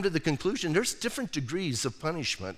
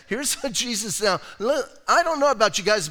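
usual speaking voice

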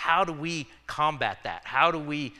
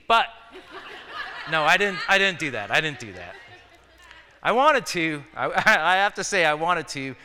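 louder, amused backpedal through laughter; laughing denial; 3 semitones higher, 5 dB louder than usual. Real, not acted. Preacher taking back a joke about his sister, laughing. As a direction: break into a laugh while owning up.

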